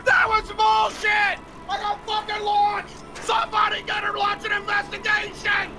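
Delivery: real rage after getting launched; raging rant. Real, not acted. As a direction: blow up like a conspiracy talk-show host. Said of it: "you sound like Alex Jones of Infowars".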